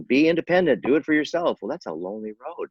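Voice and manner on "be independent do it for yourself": much higher, louder, mock cheerleader slogan. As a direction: sell the slogan in a hammed-up way. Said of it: "the world is, is touting".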